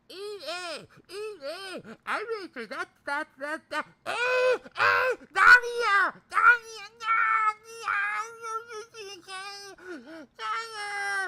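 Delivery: very high, squealing panicked babble; high-pitched mock wailing; Miko. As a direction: squeal frantically in a put-on high voice, bursting loud. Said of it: "that was my Miko imitation".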